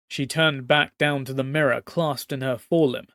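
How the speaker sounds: ordinary delivery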